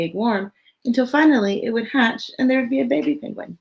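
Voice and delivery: lively, expressive storybook read; reading to children. Acted. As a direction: read it out to a room of little kids. Reads picture book aloud.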